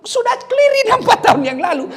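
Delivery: hammed-up wife bringing up the past; mock nagging wife. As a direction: play the wife digging up old history. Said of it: "dia bilang".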